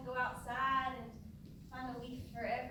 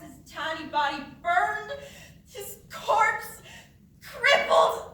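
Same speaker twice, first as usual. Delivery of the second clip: climbing louder and higher, slowly, with a laugh breaking in; unhinged building rant; 7 semitones higher, 13 dB louder, much slower than usual. Acted. Mother relives finding her burned son, climbing unhinged. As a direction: savour each word as it climbs.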